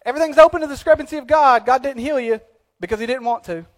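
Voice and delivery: a lightly voiced glib fatalist; mock fatalist. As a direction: rattle it off like a careless believer.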